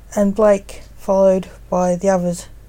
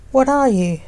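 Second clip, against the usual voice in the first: sharp, higher demand; 5 semitones higher than usual. A narrator's reading. Blake confronts the shadow figure, demanding and higher. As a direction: press the stranger hard for an answer. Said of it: "demanded Blake".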